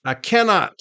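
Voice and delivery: usual speaking voice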